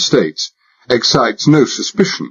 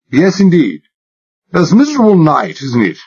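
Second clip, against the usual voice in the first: put-on high-class English accent laid on thick, higher; exaggerated British accent; 9 semitones higher, 3 dB louder than usual. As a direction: play the posh Englishman to the hilt. Narrator voices himself as posh Englishman.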